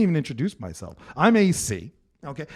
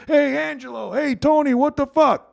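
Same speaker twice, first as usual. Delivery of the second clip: high, louder mock shouting across a dinner table; Italian family yelling; 10 semitones higher, 6 dB louder than usual. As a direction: call out like deaf relatives over Sunday dinner. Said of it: "so they all yelled".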